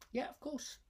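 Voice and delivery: high, thin elder voice; high old sage. Acted. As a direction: answer like a frail old sage. Old man answers.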